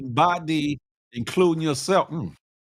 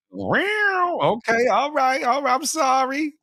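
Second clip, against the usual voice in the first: mock apology; 10 semitones higher, slower than usual. Host mock-apologizes to chat.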